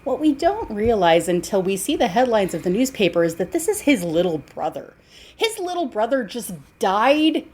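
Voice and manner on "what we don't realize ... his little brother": usual speaking voice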